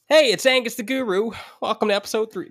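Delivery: a squeaky, hammed-up self-parody; impression of himself. Shown as a demonstration. Host mocks his own podcast intro in a high voice. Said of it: "I can do a really good Angus the Guru impression".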